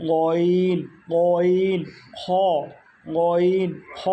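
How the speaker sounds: loud, drawn-out "গইন", rasped "খ" from top of throat; twisted upper-throat sounds. Shown as a demonstration. Teacher models the last throat letters, loud and throaty. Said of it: "আওয়াজকে পেঁচায় উচ্চারণ করতে হয়".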